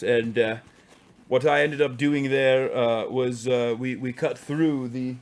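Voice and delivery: mock-posh English accent. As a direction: play the posh English gent. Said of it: "I can't do the accent anymore".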